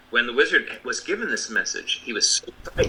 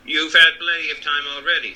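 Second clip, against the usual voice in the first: a touch of anger, louder; angry Tin Woodman; 3 semitones higher, 5 dB louder than usual. A narrator's reading. The Tin Woodman scolds the stalling Oz, mildly angry. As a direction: snap at the wizard, mildly. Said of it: "said the Tin Woodman angrily".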